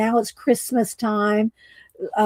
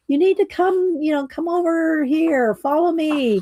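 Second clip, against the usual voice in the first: a higher, lightly voiced little owl urging; owl calling; 6 semitones higher than usual. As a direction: beckon him along in a cute owl voice. Owl calling Leon to follow.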